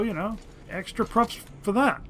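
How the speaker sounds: usual speaking voice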